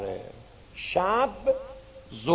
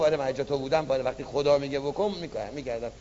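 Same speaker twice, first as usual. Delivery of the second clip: quick quiet answer; 6 semitones lower, 6 dB quieter, much faster than usual. Acted. God quick soft answer.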